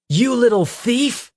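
high, loud furious yell at a thief; angry cry. Acted. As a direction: shout it out in outrage. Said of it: "Peter cried".

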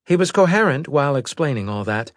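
brisk military woman reporting; female officer voice. A narrator's reading. Female officer briefs admiral.

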